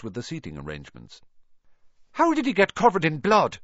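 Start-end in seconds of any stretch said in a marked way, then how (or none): 2.1-3.6 higher, louder eager question; eager interest. A narrator's reading. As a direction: ask with keen interest. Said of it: "asked Seamus with great interest".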